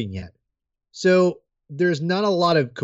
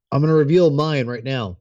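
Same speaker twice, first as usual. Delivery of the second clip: normal talking voice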